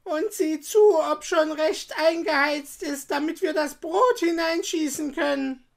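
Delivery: high, loud witch's order. A narrator's reading. Witch tricking Gretel at the oven, commanding. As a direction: instruct her loudly in a high witch voice. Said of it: "sagte die Hexe".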